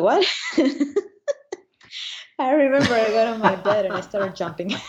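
high voice breaking into laughter; laughing disbelief. Real, not acted. Pilot recalling seeing the third-place result, laughing in disbelief. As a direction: tell it through delighted laughter. Retelling surprise at placing third.

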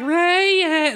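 squeaky high mock cheer; mock him in a high squeal. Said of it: "it's like".

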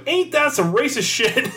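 put-on high, slow, laughing old woman; mock outraged old lady with a laugh. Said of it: "And she goes".

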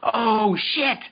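high, loud mock panic; wife's shocked cry. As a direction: cry out as if the envelope is wrong. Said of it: "She goes".

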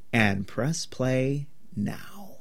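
slow breathy whisper. Acted. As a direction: hush it out slowly. Hushed cue to start movie.

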